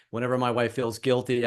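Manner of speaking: normal talking voice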